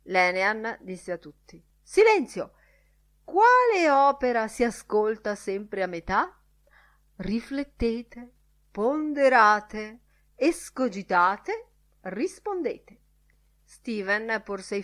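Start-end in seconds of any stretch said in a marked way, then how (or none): 1.8-2.5 loud, high-pitched command; sharp shout for attention; calling for silence. Acted. Lenihan calls everyone to silence. 3.2-13.0 slow, raised, theatrical challenge; riddle-master. Acted. Lenihan theatrically poses his riddle.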